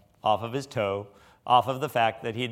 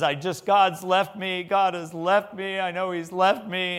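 higher-pitched, distressed mock wail; retold plaintive complaint, pitched up; imitating upset woman; 6 semitones higher than usual. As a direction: play the distraught woman without real tears. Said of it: "And she's crying, and she is upset".

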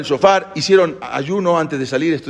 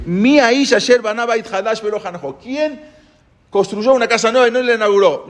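higher, ringing call to the ranks; commander's proclamation; 9 semitones higher than usual. Retold or imitated. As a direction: announce it to the whole army. Leader proclaiming before troops.

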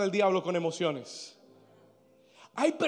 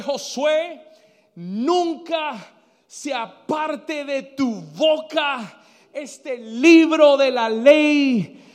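raised, emphatic preacher's voice; forceful preaching; 4 semitones higher, 4 dB louder, slower than usual. Acted. Preacher urging congregation to keep God's word, forceful preaching. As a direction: preach it with drawn-out key words.

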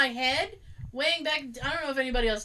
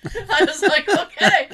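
loud, high laughing reply; laughing okay; 6 semitones higher, 10 dB louder than usual. Real, not acted. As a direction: laugh your way through it. Laughing at coworker's wine plan.

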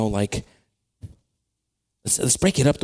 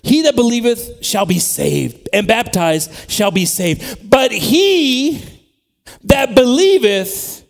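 loud, slow, raised proclamation; emphatic scripture reading; 5 semitones higher, 6 dB louder, much slower than usual. Real, not acted. Preacher reading the verse's warning to the church, emphatic proclamation. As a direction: declaim it with long pauses before the hard words.

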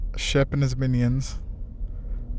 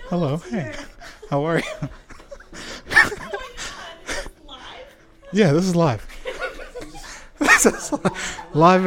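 laughing, high and happy; joyful reunion; 6 semitones higher than usual. Real, not acted. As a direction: laugh through the greeting. Laughing, greeting reunion live.

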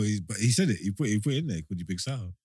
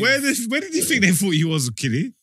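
high disbelieving question; incredulous; 8 semitones higher than usual. Real, not acted. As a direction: ask in mock disbelief.

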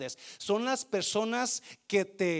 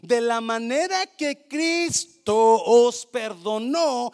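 slow scripture reading; 5 semitones higher, 10 dB louder, slower than usual. Acted. Reading verse.

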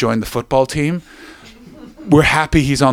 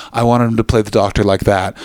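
ordinary talk

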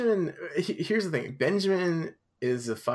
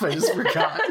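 laughing; 6 semitones higher, 7 dB louder than usual. Real, not acted. Laughing at own mistake.

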